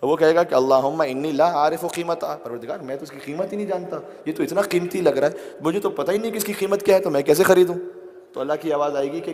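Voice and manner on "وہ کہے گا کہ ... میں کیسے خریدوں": louder, higher pleading sermon voice; impassioned preaching. Real, not acted. Preaching at full force. (جوشیلی خطابت، بلند آواز)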